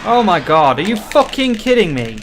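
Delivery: loud, exasperated yell at the game; furious disbelief, shouted out loud; raging gamer. Real, not acted. Nearly dies in a fight.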